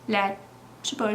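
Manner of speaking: ordinary talk, nothing marked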